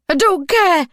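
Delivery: high, loud, furious; defiant shout. Acted. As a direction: yell it at him. Captive shouts back.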